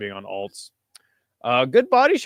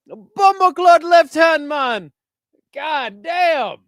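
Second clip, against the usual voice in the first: higher and louder, a slow Jamaican-tinged "Bumbleclot" hype shout; hyped yell; 12 semitones higher, 8 dB louder, slower than usual. Real, not acted. Streamer celebrating the knockout, in a hyped yell. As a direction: draw it out in joyful disbelief.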